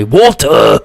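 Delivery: water said in a mock British accent, wɔltə, drawn out; a bad British water. Shown as a demonstration. Reactor imitating how Brits say water, mock British accent. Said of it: "British person saying water".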